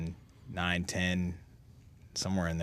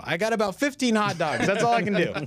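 high and loud sheepish proclamation; hammed-up announcement; 13 semitones higher, 6 dB louder than usual. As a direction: blurt it out to the whole crowd. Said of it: "I'd go".

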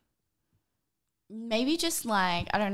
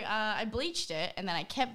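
ordinary talk

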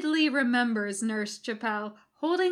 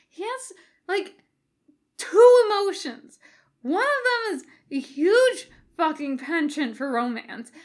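high, loud, rushed protest; excited Jim; 10 semitones higher, 7 dB louder than usual. A narrator's reading. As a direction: plead your case, worked up.

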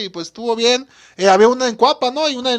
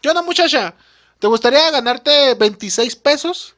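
pretend host; 6 dB louder than usual. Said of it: "porque me imagino, mira, tú llegas acá".

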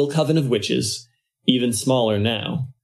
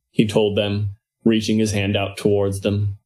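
usual speaking voice

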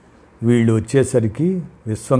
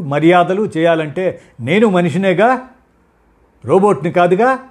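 higher and louder, furious protest; angry retort; 7 semitones higher, 5 dB louder than usual. A narrator's reading. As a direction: shout her exhaustion at him. Wife yells back in quarrel. (కోపంగా, గట్టిగా అరుస్తూ)

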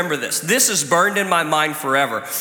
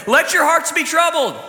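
mock misquote; 11 semitones higher than usual. Shown as a demonstration. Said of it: "that's not what it says".

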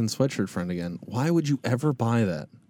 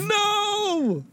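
shrill high mock protest; high squeal.

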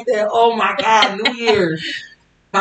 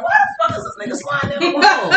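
laughing disbelief; 11 semitones higher than usual. Retold or imitated. Watching boyfriend slide down wall.

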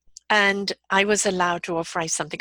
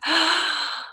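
big indrawn gasp of shock; exasperated parent's gasp; sharp gasp; 10 semitones higher than usual. Shown as a demonstration. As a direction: suck in a startled breath. Parent reacting to child's misbehavior.

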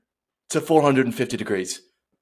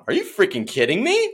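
amazed; 8 semitones higher than usual. Retold or imitated. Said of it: "I was going".

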